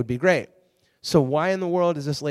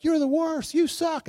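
mock yelling fans; 13 semitones higher, slower than usual. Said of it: "they were just like".

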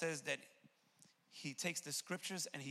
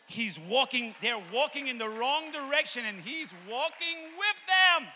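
high, loud shout building to a stretched finish; soaring preacher's shout; full-force preaching; 10 semitones higher, 13 dB louder than usual. Real, not acted. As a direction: cry it out to the congregation, drawing out the last words.

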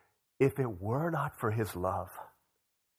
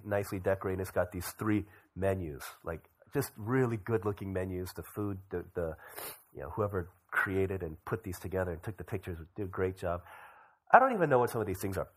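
usual speaking voice

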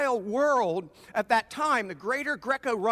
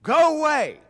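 loud, higher cry-out; demon's loud cry; 4 semitones higher, 8 dB louder than usual. Acted. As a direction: call it out at the top of your voice. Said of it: "he cried out at the top of his voice".

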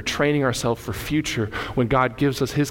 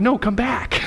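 mock plea; 12 semitones higher, slower than usual. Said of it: "I'm like".

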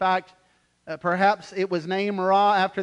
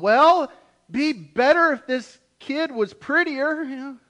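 mock grumbling parent; 7 semitones higher, 6 dB louder than usual. Parent complaining about newborn at hospital.

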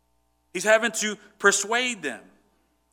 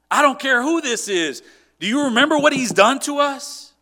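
much higher, louder furious outburst; angry retort; 9 semitones higher, 7 dB louder than usual. Retold or imitated. David's men raging about Saul, much higher and louder. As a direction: throw his past crimes back at David.

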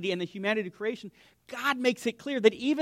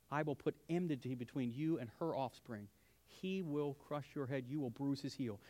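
hushed solemn reading; 6 semitones lower, 15 dB quieter than usual. Acted. Preacher quoting God to the serpent in a hushed, reverent voice. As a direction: speak it like a sacred secret. God's words spoken to serpent.

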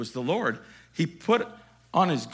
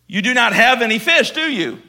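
teasing question; 10 semitones higher, 13 dB louder than usual. Acted. Jesus teasing the fishless disciples.

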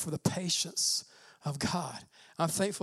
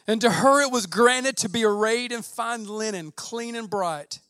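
booming scripture reading; 2 semitones higher, 8 dB louder than usual. Acted. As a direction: read it like a herald from the throne. Dramatic reading of Revelation.

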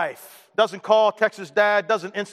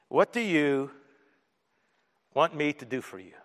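gentle question; 5 semitones lower, 7 dB quieter than usual. Retold or imitated. Jesus asking the blind man what he wants, gentle. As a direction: ask it tenderly, leaving space between phrases.

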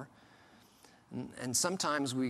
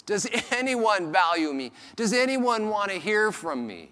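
raised, pleading plea; desperate man; 7 semitones higher, 6 dB louder than usual. Retold or imitated. Vulgar man pleading for worth, desperate. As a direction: ask to be valued, straining upward. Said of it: "in his vernacular".

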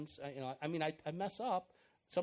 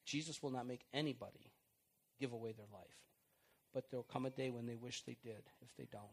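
ordinary talk, nothing marked

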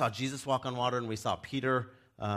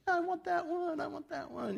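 put-on high, excited kid-like pointing; mock eager high voice; eager pick. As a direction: squeal it like picking a puppy. Congregant eagerly picking missionary.

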